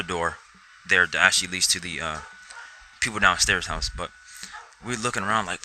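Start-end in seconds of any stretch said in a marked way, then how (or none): none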